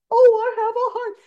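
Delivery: a tin man who just found his heart; overjoyed Tin Man. Retold or imitated. Teacher retelling the Tin Man finding his heart, high and overjoyed.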